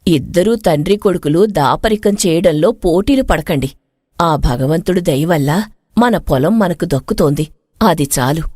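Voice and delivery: mother gently stopping father and son from arguing; gentle mother. Acted. Mother ends father-son quarrel.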